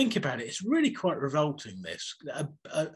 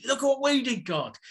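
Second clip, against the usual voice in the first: excited boast; 10 semitones higher, 4 dB louder than usual. Retold or imitated. Elijah bragging to God, dancing.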